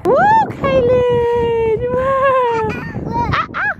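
high loud cheering; excited cheer. Real, not acted. Mom cheering her son as he swings.

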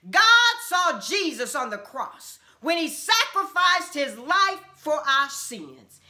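normal talking voice

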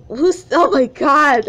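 ordinary delivery, nothing marked

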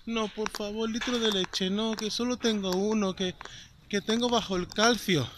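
put-on pleading old lady. Elderly shopper begging the charity volunteer for her milk, imitated pleading. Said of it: "Y la mujer le dice".

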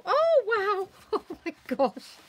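high, loud burst of wonder then a laugh; amazed wow. Real, not acted. Seeing what Karen created, loud amazed wow with a laugh. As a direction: let the wow jump out of you.

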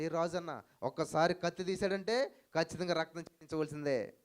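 ordinary talk

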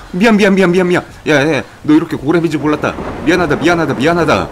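loud, high panicky apology; frantic apologizing. Real, not acted. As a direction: beg for mercy in a rush. Frantic apologies to a strong creature.

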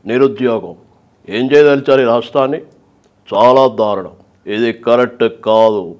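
Chandrababu Naidu at a press meet. Shown as a demonstration. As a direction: question the chief minister calmly but firmly.

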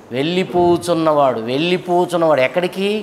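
usual speaking voice

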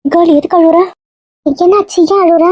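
high-pitched, worried questioning; alarmed concern. Acted. Finds friend crying, asks anxiously. (பதற்றமான அக்கறையுடன், உயர்ந்த குரலில்)

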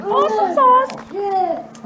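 high, drawn-out playful sing-song; sing-song awesome sauce. Real, not acted. Mom cheering about dinner in a high sing-song. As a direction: stretch it out like a cheer. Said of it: "it's gonna be awesome".

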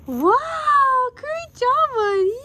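high, loud gasping praise; excited praise. Real, not acted. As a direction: gush over the drawing. Praising child's drawing.

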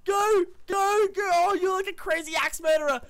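high-pitched frantic egging-on; excited cheering. Real, not acted. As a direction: cheer your teammate on, voice way up high. Cheering a teammate in a fight.

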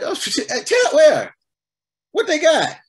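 high, loud, excited questions; suddenly eager. Acted. Preacher acting out a listener suddenly hooked by a restaurant tip, suddenly eager. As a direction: burst out with hammed-up curiosity. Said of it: "Your whole attitude changes".

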